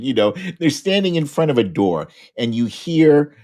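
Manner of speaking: normal talking voice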